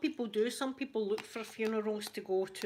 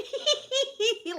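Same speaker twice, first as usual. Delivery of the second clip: squealing laugh, much higher and louder; high burst of laughter; 7 dB louder than usual. Shown as a demonstration. Showing how she giggled watching the spider, high squealing laugh. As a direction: let a suppressed giggle escape high. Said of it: "like that".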